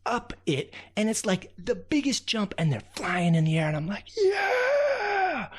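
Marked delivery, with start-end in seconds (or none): 4.1-5.5 very high, long joyful yell; excited cheer. Acted. Raccoon whooping as the ants fly off the anthill.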